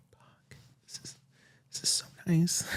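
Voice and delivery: whispered inner thought. Retold or imitated. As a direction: breathe the words out with no voice. Thinking to himself at gala.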